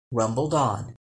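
plain reading voice

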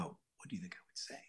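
very soft, low whisper; whispered aside. Retold or imitated. Actor put on the spot before co-stars, whispering. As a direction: whisper it back, caught off guard.

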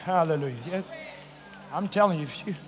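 low soft appeal; quiet. Acted. Softer closing appeal.

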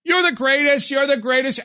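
over-the-top high-pitched fan voice; obnoxious loud talker. Retold or imitated. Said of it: "constantly talking like this".

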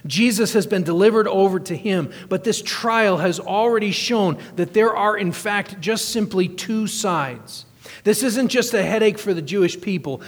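fervent pulpit delivery; preaching. Acted. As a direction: drive the point home to the congregation. Preacher building to full force.

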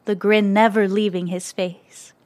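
ordinary delivery

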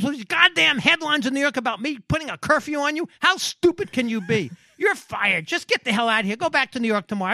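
high, hard scolding rant; angry boss ranting. Retold or imitated. Boss firing his PR guy over the curfew story, angry rant. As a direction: tear into him and send him home. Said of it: "He was pissed".

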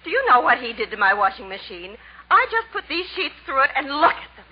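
high, indignant squawk; angry housewife. Acted. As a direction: shriek about the ruined sheets.

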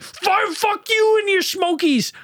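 very high, drawn-out mock-furious yell; heated outburst. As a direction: imitate a man losing his temper. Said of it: "like, getting heated".

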